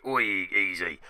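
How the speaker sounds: light put-on Cockney; playful Cockney. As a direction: slip back into a London twang. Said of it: "Back on with a Cockney".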